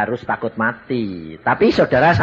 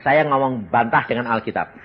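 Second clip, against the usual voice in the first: usual speaking voice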